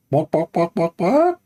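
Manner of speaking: lightly voiced hen clucks; mock chicken clucking. Mock hen clucking to show ants are birds.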